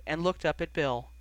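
plain reading voice

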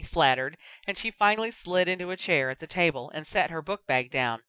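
plain reading voice

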